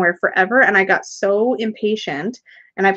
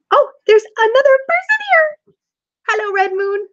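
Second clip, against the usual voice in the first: high, loud excited squeal; thrilled greeting to a new viewer; 14 semitones higher, 4 dB louder than usual. Real, not acted. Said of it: "I got so excited".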